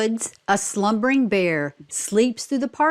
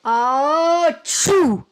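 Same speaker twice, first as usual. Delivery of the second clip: big playful mock sneeze, higher and loud; a story bear's booming pretend sneeze; acted bear sneeze; 6 semitones higher, 3 dB louder than usual. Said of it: "He blows and he sneezes".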